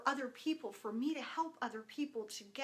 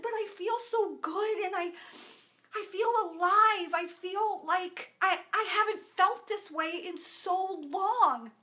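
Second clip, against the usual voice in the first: excited; 7 semitones higher, 6 dB louder than usual. Retold or imitated. Excitedly telling husband she feels alive.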